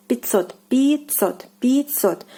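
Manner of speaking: пятьсот said "pitsot", я as и and ть as ts. Shown as a demonstration. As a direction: model пятьсот as "pee-TSOT". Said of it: "So я is more like и".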